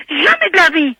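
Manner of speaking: high, loud flat-out denial; real indignant refusal. Real, not acted. Woman rejecting the midnight wake-up call, indignant refusal. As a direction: refuse loudly, pitched right up.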